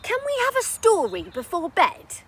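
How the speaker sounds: put-on high, loud little boy asking; child pleading for a bedtime story. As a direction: beg like a kid at bedtime.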